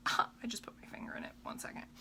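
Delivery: hushed whispered aside; whispering. Real, not acted. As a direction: whisper it off to the side. Finger in product, aside.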